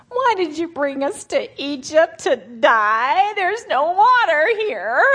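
whining grumbling Israelites. Retold or imitated. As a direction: whimper like a sulking crowd. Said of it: "All the Israelites grumbled".

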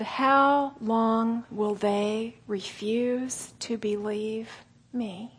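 very slow, fading lament; God grieved at unbelief; sorrowful God. Retold or imitated. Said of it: "And God said".

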